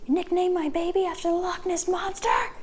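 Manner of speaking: squeaky, scandalized movie-heroine impression; indignant Bella. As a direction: play Bella appalled at the baby's nickname. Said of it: "Bella's first reaction was correct".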